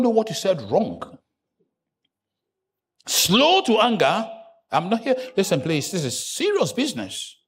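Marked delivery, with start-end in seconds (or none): 3.1-4.3 slow emphatic repeat. Shown as a demonstration. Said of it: "repeating key phrase".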